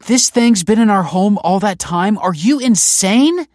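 very high, loud disbelief; outraged shout. A narrator's reading. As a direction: yell it, appalled at the secret. Outraged at Michael's secret.

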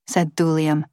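plain reading voice

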